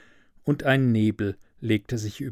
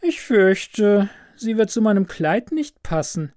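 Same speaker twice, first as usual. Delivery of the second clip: put-on high, dismissive girl's voice; cool daughter; 7 semitones higher than usual. A narrator's reading. Professor's daughter rejecting the student's rose, high and dismissive. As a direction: brush him off lightly. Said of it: "antwortete sie".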